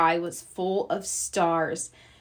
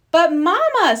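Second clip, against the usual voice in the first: pleading cub; 8 semitones higher, 6 dB louder than usual. Acted. Brother protests to Mama.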